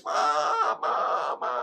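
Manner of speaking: very high, loud wail for mother; crying shout. Retold or imitated. Lost child screaming for mother, tearful shout imitated. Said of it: "Saya cuma teriak, nangis".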